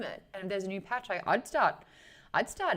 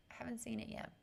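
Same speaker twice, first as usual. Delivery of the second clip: breathy whispered repeat, much softer; 3 semitones higher, 11 dB quieter, faster than usual. Real, not acted. Streamer answering chat about Wentworth, whispered. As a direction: drop to a hushed whisper, as if sharing a secret.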